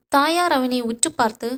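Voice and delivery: usual speaking voice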